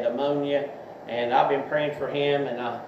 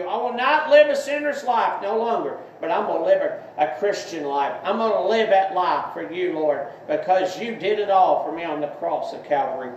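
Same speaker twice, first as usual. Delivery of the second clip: earnest spoken prayer, leading others; 5 semitones higher than usual. Acted. Preacher voicing the sinner's prayer for listeners, earnest prayer. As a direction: pray it out for them to repeat.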